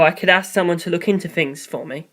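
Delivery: ordinary delivery, nothing marked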